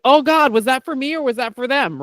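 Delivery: high-pitched mock alarm; retold panic. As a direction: act out a sudden inner panic. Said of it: "I would start to panic".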